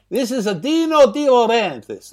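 hammed-up Italian accent; over-the-top Italian accent. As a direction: repeat the name in a caricature Italian accent. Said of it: "most over-the-top and unbelievable Italian accent".

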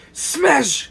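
mock battle cry. Mock feast-hall cry.

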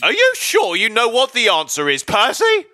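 loud, high shouted question; shouting. Acted. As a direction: belt it out like a game show host. Game show host shouting question.

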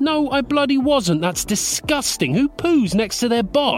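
high-pitched, outraged denial; indignant. Acted. Boss denying he pooed beside colleague, indignant. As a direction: protest it high, appalled at the idea.

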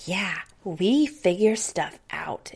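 put-on slow, whispered little-girl echo; imitating little daughter. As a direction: whisper it back like a small child copying mom. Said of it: "she's just sitting there going".

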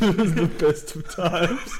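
laughing. Real, not acted. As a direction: giggle through the quote. Laughing quote.

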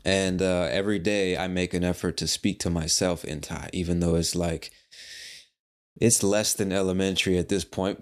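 usual speaking voice

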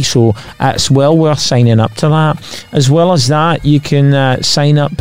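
sign-off in put-on Scottish; Scottish accent. Host plugging the Patreon as the episode ends, Scottish accent. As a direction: keep up a mock Scottish accent. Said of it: "in our Scottish accent".

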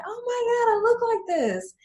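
very high, loud mock squeal; amazed client. Client seeing herself in the camera, amazed squeal.

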